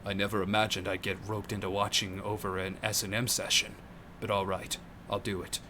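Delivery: deep, low, soft yakuza grumble; Kiryu voice. Acted. Kiryu reluctantly agreeing to watch the session, deep and low. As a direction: drop way down and mumble it gruff. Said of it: "Kiryu's voice is, like, impossible to emulate".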